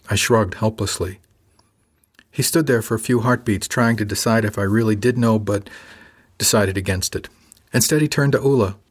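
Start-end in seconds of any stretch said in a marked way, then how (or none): none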